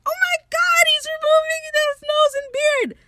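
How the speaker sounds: very high, loud panicked shriek; hammed-up horrified crowd scream; mock screaming. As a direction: scream it in exaggerated high-pitched alarm. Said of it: "they're all screaming like".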